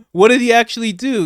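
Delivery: high, loud mocking voice of the guest; mock outraged woman. Reactor mocking the TV guest, high shrill parody. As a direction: squeal it like an outraged partisan.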